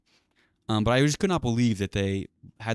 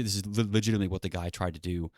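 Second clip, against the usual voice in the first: normal talking voice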